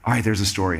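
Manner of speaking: low-pitched, grudging concession; mock-resigned admission, pitched well down; reluctant giving in. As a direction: imitate a friend caving, voice dropped. Acting Miles reluctantly giving in.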